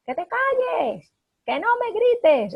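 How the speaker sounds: parent yelling. Retold or imitated. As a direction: belt it out like an exasperated parent. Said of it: "yo no puedo estar gritando".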